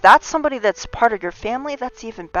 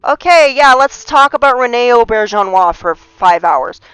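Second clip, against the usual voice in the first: higher and louder, overeager chatter; mock eager rambler; 4 semitones higher, 9 dB louder than usual. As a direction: ham up the chatty self. Mocking herself rambling on.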